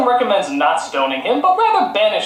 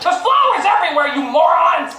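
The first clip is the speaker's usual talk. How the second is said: exasperated yell, higher; angry shout; 8 semitones higher than usual. Real, not acted. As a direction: shout at the writers. Ranting at filmmakers.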